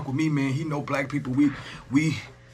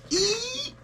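high mock squeal; a playful high eek; high squeak. Teasing streamer squeals at his viewers.

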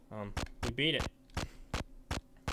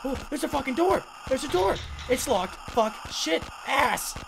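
high, loud bursts of cursing; worked-up, high-pitched shouts; frustrated shouting; 10 semitones higher, 5 dB louder than usual. Real, not acted. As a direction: yell in a rush at a locked door. Finds door, it is locked.

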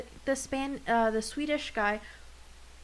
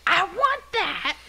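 loud, high, drawn-out demanding yell; imitated yelling; 8 semitones higher, 7 dB louder, slower than usual. Cast member mimicking Yurio's half-the-time yelling in the dub, yelling. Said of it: "the kid is fucking yelling".

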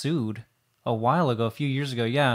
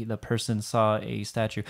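ordinary talk

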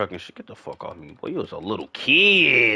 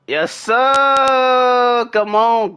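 high, loud, drawn-out cheer; hyped celebration; 12 semitones higher, 5 dB louder, much slower than usual. Real, not acted. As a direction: whoop it up after a big play. Celebrating a play in the game.

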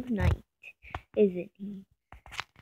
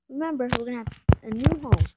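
normal talking voice

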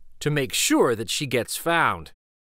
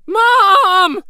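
loud high cry for mom in the dark; frightened shout; 12 dB louder than usual. Acted. Child calling mom at night, loud frightened shout.

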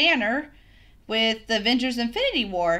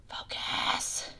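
whisper; 11 dB quieter than usual. Real, not acted. Urging the blurry camera.

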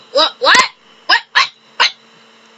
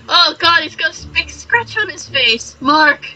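ordinary talk

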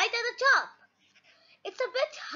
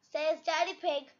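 plain reading voice

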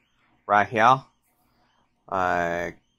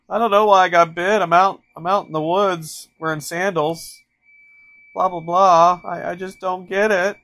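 higher, louder whiny voice of a clueless hiker; mock whining victim; 7 semitones higher, 6 dB louder than usual. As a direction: play the dopey victim who can't see why, pitched up. Mocking a clueless snakebite victim.

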